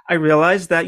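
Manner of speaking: ordinary talk, nothing marked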